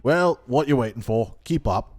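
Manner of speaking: a rough-voiced guard; gruff guard voice. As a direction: bark it like a surly jailer. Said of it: "Don't know why I gave him that voice".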